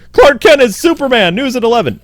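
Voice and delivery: high, loud mock announcement; mock news bulletin. Someone exposing Superman's secret identity, excited mock newsflash. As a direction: blurt out a hammed-up breaking headline.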